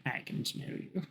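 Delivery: menacing whisper; creepy whisper. Pretend. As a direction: breathe it like a stalker closing in. Hunter sniffing out hidden man.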